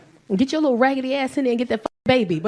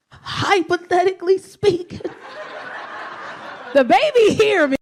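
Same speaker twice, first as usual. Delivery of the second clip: higher, laughing nervous son; 6 semitones higher than usual. Retold or imitated. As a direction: squeeze it out through laughter. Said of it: "He hit me with the".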